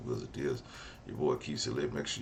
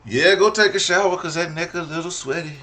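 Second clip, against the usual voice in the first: much higher, louder playful tease; loud teasing; 14 dB louder than usual. Real, not acted. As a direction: rib her loudly about the sweaty neck. Cohost teasing loudly.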